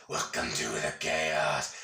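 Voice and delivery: very low, slow put-on voice; movie-style announcement. Quoting a movie about the chat, deep and slow. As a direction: drop right down and drag it out. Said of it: "It is the legit Welcome to the Chaos".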